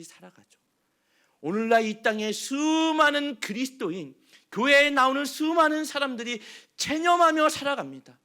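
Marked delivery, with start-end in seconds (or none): 1.4-8.2 loud, high sermon climax; full-force preaching. Acted. Pastor warning churchgoers about resignation, full-force preaching. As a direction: preach it hard to the pews.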